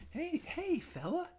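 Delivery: butter up the grumpy clerk in a high sweet voice; overly friendly voice. Retold or imitated. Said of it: "trying to be all friendly with my tone".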